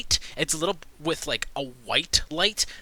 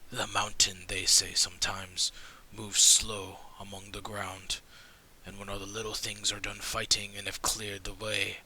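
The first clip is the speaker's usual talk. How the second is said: hushed conspirator; 6 semitones lower than usual. Acted. Game master voices a grim conspirator warning the party, in a low whisper. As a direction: lean in and share a dark secret.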